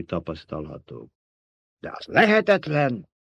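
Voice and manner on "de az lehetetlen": higher-pitched, affronted objection; indignant protest. A narrator's reading. Baron objects to the emperor's report, indignant. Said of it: "méltatlankodott a báró".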